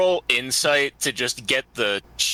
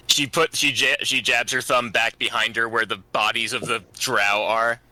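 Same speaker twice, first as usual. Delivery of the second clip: ordinary talk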